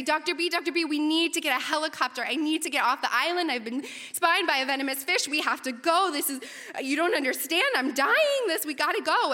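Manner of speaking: high, urgent mock-panicked plea; freaking out. Stung researcher begs advisor for a helicopter, panicked pleading. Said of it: "and I'm freaking out".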